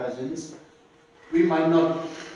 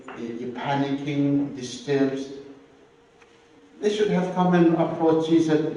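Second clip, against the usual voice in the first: ordinary talk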